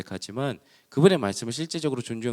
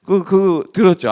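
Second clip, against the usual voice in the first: ordinary delivery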